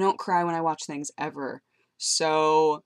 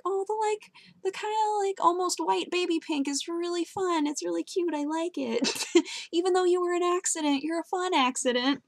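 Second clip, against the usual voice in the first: high, soft coo of affection; 12 semitones higher, 3 dB quieter than usual. Real, not acted. Talking fondly to the faded pink hair, high cooing.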